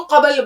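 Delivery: قبل opened into two syllables, qa-bal; common mispronunciation. Retold or imitated. Teacher quoting people's common mistake, قبل said qabal. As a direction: say قبل as qabal, vowel after the b. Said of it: "غلط بولتے ہیں".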